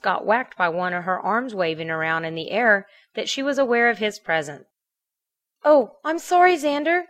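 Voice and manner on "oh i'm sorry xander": high, loud startled squeal of apology; excited squeal. A narrator's reading. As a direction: squeal it out despite yourself. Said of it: "she squealed in spite of herself".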